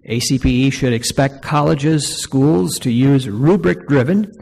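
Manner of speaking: loud, projected reading of a formal statement; formal read-aloud. Real, not acted. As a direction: read it out firmly to a room. Reads numbered recommendation aloud to audience.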